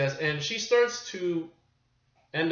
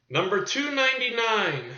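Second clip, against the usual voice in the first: higher, projected episode announcement; announcer call; 7 semitones higher, 4 dB louder than usual. Pretend. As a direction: call out the number like a host. Announces episode number, then echoes it.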